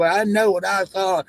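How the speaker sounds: high, slow mock witness; mocking imitation. Streamer mocks the same claimed sighting, imitating the witness. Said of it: "you say you ain't seen shit".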